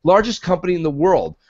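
ordinary talk, nothing marked

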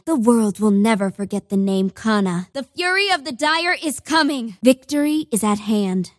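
normal talking voice

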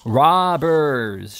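long accusing yell from a window; indignant shout. A narrator's reading. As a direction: holler it after departing enemies. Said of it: "shouted Mister Pickwick".

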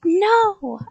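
high, loud refusal; yelled. A narrator's reading. Child fleeing up the stairs, yelled. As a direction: yell it in alarm. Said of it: "I yelled".

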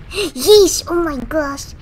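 loud, high yelp of shock; startled outburst. Real, not acted. Player startled by the game, loud real yelp. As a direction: jump and blurt it out loud.

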